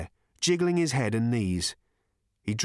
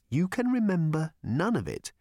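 incredulous Arthur; 6 semitones higher than usual. Acted.

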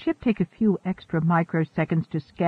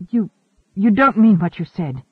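stammering; 3 semitones higher, 5 dB louder than usual. A narrator's reading. Shocked crewmate challenging the captain, stammering. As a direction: falter on the first word, then push on. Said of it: "she stammered".

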